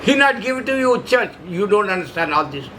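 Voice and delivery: usual speaking voice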